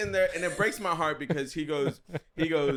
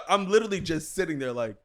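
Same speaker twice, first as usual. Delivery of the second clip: ordinary talk, nothing marked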